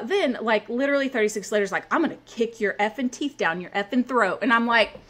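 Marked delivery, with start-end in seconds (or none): none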